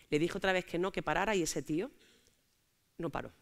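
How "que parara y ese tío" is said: ordinary talk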